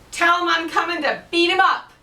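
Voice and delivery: loud, high booming threat; giant threat. Acted. Scottish giant threatening Finn, loud bellowing threat. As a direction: bellow it to scare him.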